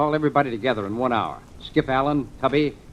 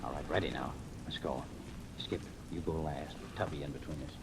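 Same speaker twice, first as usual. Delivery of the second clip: hushed; 9 semitones lower, 15 dB quieter than usual. Acted. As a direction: give the boarding order under your breath. About to board the houseboat.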